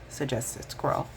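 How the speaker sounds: usual speaking voice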